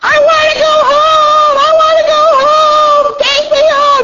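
very high, loud drawn-out wail; imitated screaming child. Preacher imitating a homesick daycare kid, screaming and wailing. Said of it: "this kid was just screaming".

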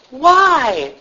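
high, put-on questioning voice; mock plaintive scolding; Eli's feeble rebuke. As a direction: ask it like an ineffectual father. Acting out Eli's weak rebuke.

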